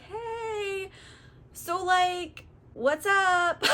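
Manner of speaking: high, awkward sheepish greeting; sheepish sailors. Retold or imitated. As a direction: play it caught red-handed, laughing nervously. Crew caught, sheepish excuse.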